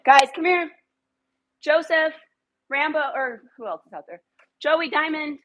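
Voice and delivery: high, loud roll call of the dogs. Real, not acted. Owner rounding up her dogs by name, high and loud. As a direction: round up the pack.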